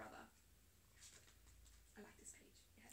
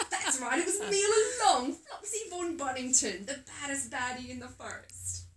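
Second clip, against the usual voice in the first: lightly voiced pantomime villain boasting; Flopsy revealing himself, gloating loudly; 3 semitones higher, 12 dB louder than usual. A narrator's reading.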